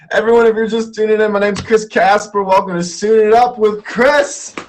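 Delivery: loud, fast, high host intro; announcer intro. Real, not acted. Host welcoming new viewers, brisk announcer voice. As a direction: rattle off the show welcome loudly.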